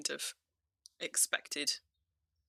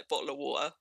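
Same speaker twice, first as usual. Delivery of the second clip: lightly put-on English accent, T's dropped; brief demo of a T-dropping British accent; faster than usual. Shown as a demonstration. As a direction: say "bottle of water" with dropped T's, English regional style. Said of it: "dropping their T's and things".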